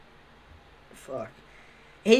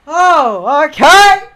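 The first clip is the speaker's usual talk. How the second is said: startled realisation; 8 semitones higher, 13 dB louder, much slower than usual. Retold or imitated. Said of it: "and I was like".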